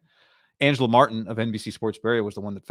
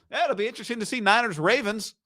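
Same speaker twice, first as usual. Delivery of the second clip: mock shrug; 7 semitones higher than usual. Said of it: "he was just saying".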